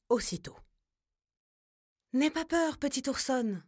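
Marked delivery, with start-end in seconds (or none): 2.1-3.6 a lightly voiced grandma bear, higher and comforting; gentle grandma bear. A narrator's reading. Grandma bear comforting the frightened cub, higher and gentle. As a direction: soothe the little cub like a grandmother. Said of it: "dit-elle doucement".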